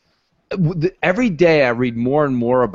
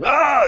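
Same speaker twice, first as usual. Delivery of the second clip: short high yelp like a gamer getting shot; imitated scream; 13 semitones higher than usual. Retelling dorm guys screaming over Halo, a high mock yelp. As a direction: mimic the guys' sudden high scream down the hall.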